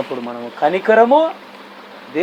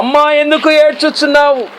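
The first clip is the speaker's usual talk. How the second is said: God's question; 9 semitones higher, 5 dB louder than usual. Acted.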